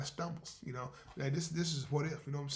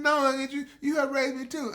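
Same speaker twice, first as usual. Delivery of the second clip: higher, louder earnest niece; 10 semitones higher, 11 dB louder than usual. Acted. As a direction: insist warmly like a grateful girl. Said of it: "She said".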